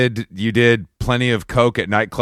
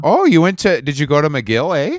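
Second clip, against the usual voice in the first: put-on Fargo accent; 4 semitones higher than usual. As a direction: tease with a hint of Fargo Canadian, eh. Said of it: "we're now doing Fargo accent".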